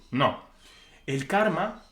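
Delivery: ordinary delivery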